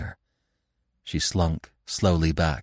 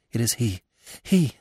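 terrified whisper; 4 semitones higher than usual. A narrator's reading. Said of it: "she whispered ... choking with terror".

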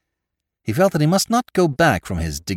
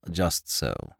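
soft, deferential butler; hushed genteel host; 4 semitones lower, 9 dB quieter than usual. Acted. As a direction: speak low like a discreet butler. Butler addresses the lord.

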